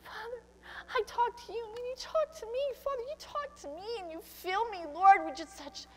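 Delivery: fervent tearful prayer. Real, not acted. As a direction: pour your heart out to God, near tears. Fervent prayer climbing toward tears.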